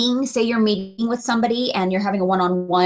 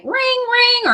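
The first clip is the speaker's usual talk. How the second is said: much higher chirpy ring, ring; mock ringtone; 13 semitones higher than usual. Speaker mimics her phone interrupting a client, high mock ring. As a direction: imitate a phone ringing.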